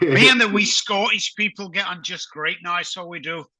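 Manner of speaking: badly faked Scottish, slipping into Northern Irish; terrible Scottish accent. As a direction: put on a hammy Scottish accent. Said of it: "That was a terrible Scottish accent".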